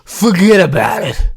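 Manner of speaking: put-on slow, drawn-out New York accent. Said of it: "That's my New York accent for the day".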